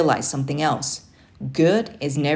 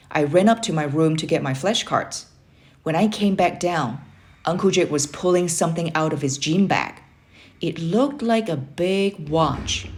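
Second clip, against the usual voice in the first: ordinary delivery, nothing marked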